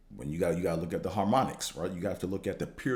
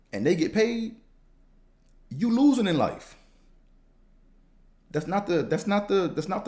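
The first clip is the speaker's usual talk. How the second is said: fired-up rant; 9 semitones higher, 4 dB louder than usual. Real, not acted. Heated rant at sports fans.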